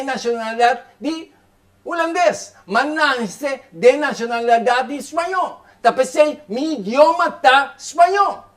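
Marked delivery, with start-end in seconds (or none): none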